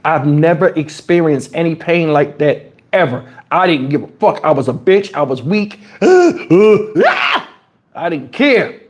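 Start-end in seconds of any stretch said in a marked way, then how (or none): 6.0-7.4 sharp gasp. Retold or imitated. Reliving the pain of extraction.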